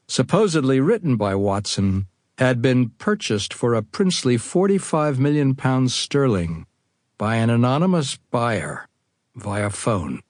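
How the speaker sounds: normal talking voice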